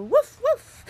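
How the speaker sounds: high, loud woofs; playful dog bark. Pretend. Doggy song chorus, high playful barking. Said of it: "Give me a woof".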